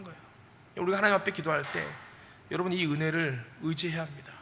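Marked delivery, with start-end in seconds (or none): none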